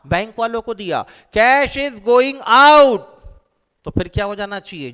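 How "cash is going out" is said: stretched out word by word; slow, deliberate dictation. Shown as a demonstration. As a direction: dictate it slowly for notes.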